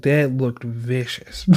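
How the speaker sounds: normal talking voice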